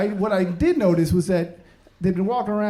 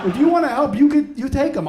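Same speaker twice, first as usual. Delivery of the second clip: higher, louder fed-up outburst; exasperated rant; 7 semitones higher, 6 dB louder than usual. Acted. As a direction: vent at her, fed up. Ranting at the waitress.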